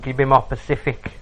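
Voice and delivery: "Pacific" said like the ocean, meaning specific; mispronounced Pacific. Retold or imitated. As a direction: say "Pacific" instead of specific, stress on -cif-. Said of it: "She went".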